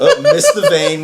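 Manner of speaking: very high laughing oops; laughing mock oops. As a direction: squeal it out through laughter. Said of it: "mock vet goof".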